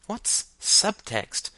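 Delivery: put-on very high, slow little-girl voice; small daughter sounding out a new word; child character. A narrator's reading. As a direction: ask slowly and high, like a puzzled child. Young Alice asks about new word.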